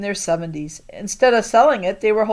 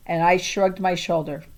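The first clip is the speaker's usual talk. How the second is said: plain reading voice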